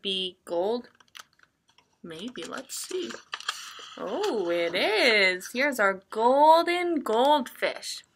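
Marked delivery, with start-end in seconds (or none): none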